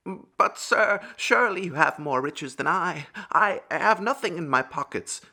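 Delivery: high-pitched protesting beggar; pleading beggar. A narrator's reading. Beggar tells farmer his pockets are empty, high pleading voice.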